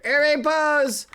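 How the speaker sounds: loud fast call to pose. Real, not acted. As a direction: shout it to the group. Calling everyone to pose.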